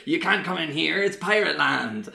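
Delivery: high, hammed-up West Country pirate accent; mock pirate in West Country tones. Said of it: "I'm doing West Country".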